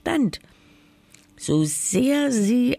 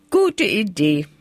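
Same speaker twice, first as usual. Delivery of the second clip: eager call; 3 dB louder than usual. Acted. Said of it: "rief das Hexlein".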